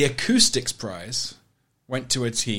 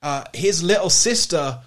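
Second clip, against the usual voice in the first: higher, louder, slow and syrupy; mock uplifting news voice; 6 semitones higher, 5 dB louder than usual. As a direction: read it like a saccharine feel-good story.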